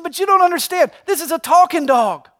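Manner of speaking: high, loud protest from an indignant dog owner; insistent pleading. Acted. Joke: owner pleads with maitre d'.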